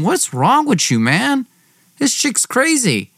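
exasperated. Retold or imitated. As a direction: push it up high, can't believe him.

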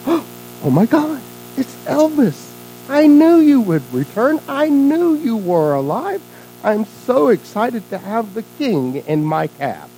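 high, slow, gushing excitement; starstruck cab driver. Acted. Cab driver finds the King in his cab, starstruck. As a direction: draw it out in awestruck delight.

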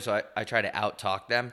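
ordinary talk

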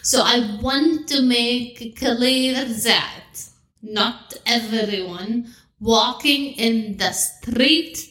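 put-on measured Arabic-accented host speech. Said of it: "we're gonna do the Arabic accent".

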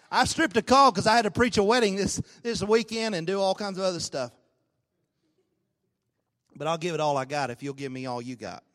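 normal talking voice

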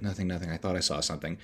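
soft, low bedtime murmur; hushed reassurance. Retold or imitated. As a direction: play down a scare quietly to a sleepy partner. Retold bedtime reassurance to partner.

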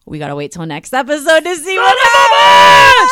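high, loud teaser shout; dramatic cliffhanger. Acted. Host teasing listeners about next episode, loud dramatic cliffhanger. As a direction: hype it like a cliffhanger.

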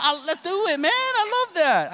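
delighted gush. Real, not acted. Enthusiastic reversal about the pho.

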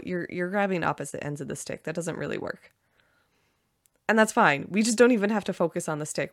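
usual speaking voice

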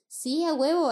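«Sí, a huevo» shouted high and loud; excited yell. Retold or imitated. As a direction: blurt out «a huevo» all pumped up. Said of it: "y el de que, "¿Qué?". («a huevo» gritado con emoción)

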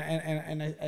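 usual speaking voice

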